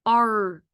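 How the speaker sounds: Art with a long "ar" and a separate final "t"; sounded out. Shown as a demonstration. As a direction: stretch the "ar" in Art, then click the t. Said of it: "Your second word is".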